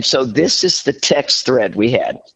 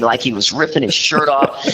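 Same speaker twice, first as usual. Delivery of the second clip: ordinary delivery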